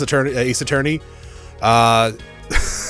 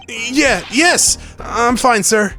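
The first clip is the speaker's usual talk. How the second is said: higher, jittery rookie voice; nervous young lawyer; 9 semitones higher, much slower than usual. Acted. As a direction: overeager and panicky, trying to sound fine. Voices Apollo.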